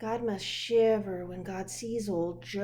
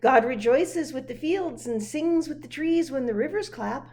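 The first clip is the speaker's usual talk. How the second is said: usual speaking voice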